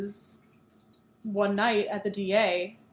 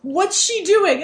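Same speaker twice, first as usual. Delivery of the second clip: urgent question; 9 semitones higher, 5 dB louder, much slower than usual. Acted. Harry asks urgently.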